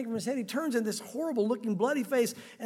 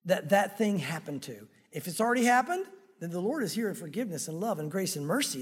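normal talking voice

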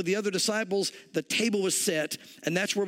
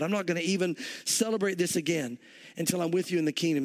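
ordinary delivery

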